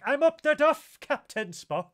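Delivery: high, very loud comic exclamation; mock outburst. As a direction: blurt it out at a mock shout, easing off on the name. Acted outburst from film scene retold.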